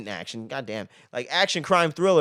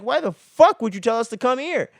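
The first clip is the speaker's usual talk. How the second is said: high-pitched angry demand, acted out; pissed-off Seth; 7 semitones higher, 4 dB louder than usual. Seth confronting Carlos for calling them here, pissed off. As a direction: play him fed up and indignant. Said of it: "Seth is pissed, man. He's like".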